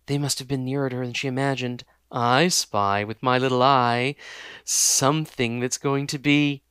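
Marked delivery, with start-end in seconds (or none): 2.1-6.6 slow sing-song taunt; creepy taunting villain. Acted. Villain taunts the collapsed girl, slow and sing-song. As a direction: savour every word of the threat.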